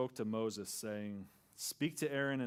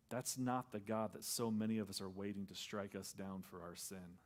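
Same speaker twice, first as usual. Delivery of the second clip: normal talking voice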